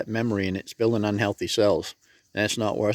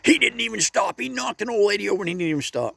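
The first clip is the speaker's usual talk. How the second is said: mock angry old lady; 10 semitones higher than usual. Old lady complaining about the driver who hit her, high and indignant. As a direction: imitate an outraged old woman. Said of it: "she was mad as a hornet".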